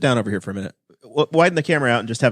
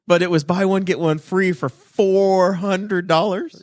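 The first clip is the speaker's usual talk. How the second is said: higher, drawn-out disbelief on the price; incredulous; 6 semitones higher, slower than usual. Real, not acted. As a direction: stretch the price out in raised-pitch disbelief. Mock challenge over the jacket price.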